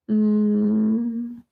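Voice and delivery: ordinary talk, nothing marked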